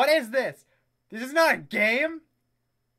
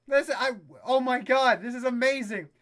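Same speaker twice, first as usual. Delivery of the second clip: ordinary talk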